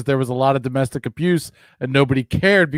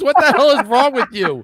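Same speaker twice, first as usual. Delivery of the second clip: incredulous; 10 semitones higher, 4 dB louder than usual. Real, not acted. Teasing disbelief at friend.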